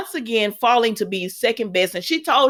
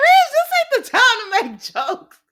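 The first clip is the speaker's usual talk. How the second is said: high, a bit louder put-on scolding voice; sassy scolding; 4 dB louder than usual. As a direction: tell Ridge off, sassy and pitched high. Her retort in the scene.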